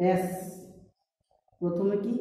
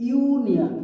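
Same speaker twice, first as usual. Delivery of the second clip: "Union" said higher and louder on "ইউ"; stressing the yoo; 7 semitones higher, 6 dB louder, much slower than usual. Shown as a demonstration. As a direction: make the "ইউ" of "union" stand out. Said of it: "ইউ বলছি". ("Union" এর "ইউ" জোর দিয়ে উঁচু স্বরে)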